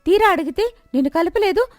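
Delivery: angry neighbour shouting accusations at the milkman's wife. Acted.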